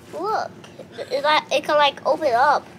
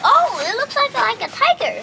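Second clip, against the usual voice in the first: excited; 12 semitones higher, 5 dB louder than usual. Real, not acted. Sudden delighted reaction to purse.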